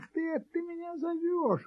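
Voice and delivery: soft, high kitten voice of astonishment; long amazed gasp of realisation in a tiny voice; surprised. A narrator's reading. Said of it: "удивился котёнок". (удивлённо, тонким голоском)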